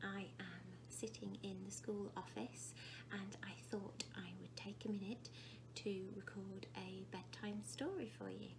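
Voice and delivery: hushed whisper, no voice at all; whispered aside. Real, not acted. Recording a bedtime story from the office, whispering. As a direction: whisper so nobody in the office hears.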